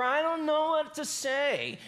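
high, drawn-out mock whining; Moses. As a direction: imitate a griping whiner, slow and high. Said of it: "He's whining, he's complaining, he's griping".

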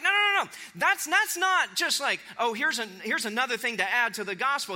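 high, protesting rebuttal; fired up. Acted. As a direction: burst in to object as Paul. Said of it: "he is fired up".

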